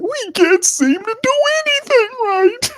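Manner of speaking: very high, whining falsetto moan; Carolina Panthers fan impression. Streamer imitating Panthers fans, high falsetto whine. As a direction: wail it like a defeated fan. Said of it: "That's what they're saying".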